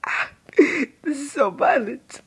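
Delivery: high, laughing whisper. Retold or imitated. Gamer whispering to his own adolescence, high and laughing. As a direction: whisper it through a giggle, high up. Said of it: "And I'm like".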